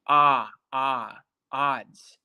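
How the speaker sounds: "Ah, ah", then "odds" with that same open vowel; isolated open vowel. Shown as a demonstration. Teacher repeating the phrase for Estefanía, "odds" with an open ah. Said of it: "Versus a, a, odds".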